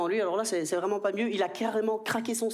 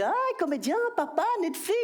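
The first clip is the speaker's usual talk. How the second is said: put-on higher, smug little-boy boast; lightly voiced bragging child; cocky kid; 9 semitones higher than usual. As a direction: show off like a proud four-year-old. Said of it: "Il est".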